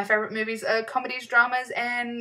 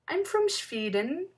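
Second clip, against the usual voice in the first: put-on Swedish accent; 7 semitones higher, slower than usual. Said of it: "Try a Swedish accent".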